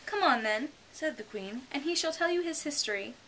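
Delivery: queen voice. A narrator's reading.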